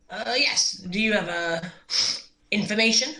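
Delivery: lightly voiced shy adventurer, voice rising; timid in-character ask. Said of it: "and be like".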